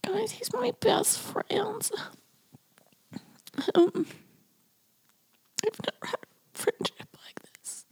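real tears rising as she talks about him; choked up. Real, not acted. Fighting tears while praising her best friend. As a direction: voice catching high and soft, close to tears.

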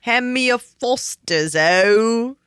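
hammed-up Aussie drawl, slowed; a clumsy stab at an Australian accent; bad Australian accent. To her cohost, asking for a beer in a bad Australian accent. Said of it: "I can't really do an Australian accent".

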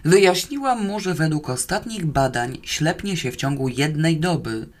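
ordinary delivery

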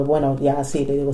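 usual speaking voice

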